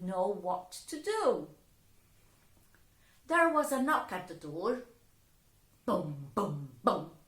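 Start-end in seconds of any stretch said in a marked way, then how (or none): 5.8-7.2 knocking. Acted. Knock sound.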